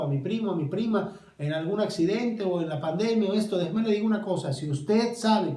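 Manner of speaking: high, loud pleading sermon voice; impassioned preaching. Acted. Pastor asking the grieving why they weep, loud and urgent. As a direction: call out to each grieving listener.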